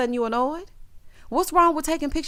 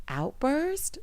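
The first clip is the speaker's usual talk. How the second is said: higher, soft, questioning echo; retold incredulous echo; 4 semitones higher, 6 dB quieter than usual. Girlfriend questioning his word for her behavior, incredulous. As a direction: toss the word back as a disbelieving question.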